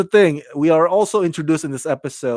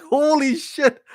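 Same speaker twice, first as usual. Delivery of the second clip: shocked; 11 semitones higher than usual. Retold or imitated. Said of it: "And I was like".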